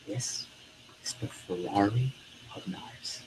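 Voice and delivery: hushed, slow and low sales reveal; dipped voice. Shown as a demonstration. Coach confides in Miss Taylor, dipped voice. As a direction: drop to a near whisper, savouring it. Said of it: "I even dip my voice".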